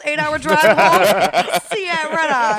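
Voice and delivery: loud, slow with laughter; gleeful mock bravado; laughing party hype. Recounting party eagerness, laughing.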